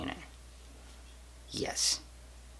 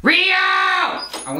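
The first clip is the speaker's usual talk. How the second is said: calling out; 6 dB louder, much slower than usual. Real, not acted. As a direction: shout up the stairs, high and loud. Calling her down from upstairs.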